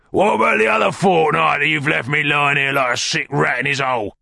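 Sikes, angrily accusing. Acted. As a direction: rage like an aggrieved bedridden thug.

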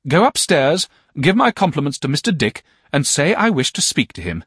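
high, loud orders to the servant; commanding aunt. A narrator's reading. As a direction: send the maid upstairs briskly. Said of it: "said my aunt".

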